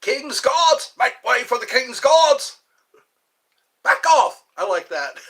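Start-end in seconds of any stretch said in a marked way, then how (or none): 0.0-2.6 loud, high British sentry shout; British guard accent. Acted. Showing off accents as King's Guard, shouted British command. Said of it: "People like my accents". 3.8-4.4 short, high, loud British bark; British guard accent. Acted. British guard warning off tourists, sharp accented shout. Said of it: "People like my accents".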